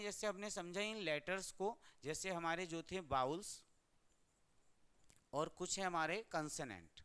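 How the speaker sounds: ordinary talk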